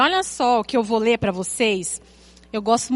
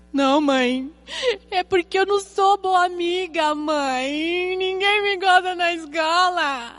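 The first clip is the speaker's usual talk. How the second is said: high, drawn-out mock wail of a tired child; hammed-up fake crying, higher and stretched out; dramatic crying child; 7 semitones higher, slower than usual. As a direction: imitate a little girl whining to mum. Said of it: "ela é dramática".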